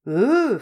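loud high indignant grunt; grunt like a curse. Acted. Old woman cursing the customer with a loud grunt. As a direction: spit the grunt out like an oath. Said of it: "comme elle lancerait un juron".

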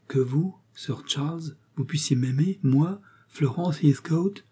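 put-on young girl asking her suitor, tearful and amazed; tearful disbelief. A narrator's reading. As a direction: ask it as if you can't believe it. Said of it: "ses beaux yeux pleins de douces larmes".

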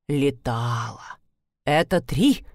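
low, slow self-answer. Acted. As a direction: drop low and drag it out. Neighbour answers her own question.

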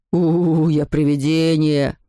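low, drawn-out spooky howl; howling ghost. A narrator's reading. Anselm plays a ghost to scare his sister, howling low. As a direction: howl slowly like a play ghost. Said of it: "поднял руки и завыл".

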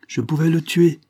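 high, loud horror at himself; shuddering. A narrator's reading. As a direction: exclaim it, appalled by the thought. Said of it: "en frémissant".

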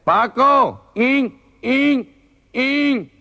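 high, loud nasal call; calling Paco in nasal speech. Acted. The clerk calls the warehouse man Paco in a high nasal yell. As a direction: shout for Paco, mock nasal. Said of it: "Paco ... que habla como este tío".

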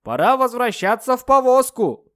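loud high call across a field. Acted. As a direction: shout it out to someone far off. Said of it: "крикнул Джордж".

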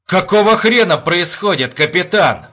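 high, loud angry demand; furious. A narrator's reading. Enraged major demands an explanation from the captain, furious. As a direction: round on him in a rage. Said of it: "взбешённо прошипел Епифанов".

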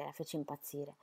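ordinary delivery

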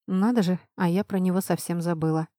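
ordinary delivery

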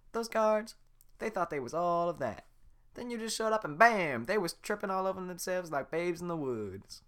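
fast-talking admirer; Donkey fanboying over Shrek; gushing Donkey. Acted.

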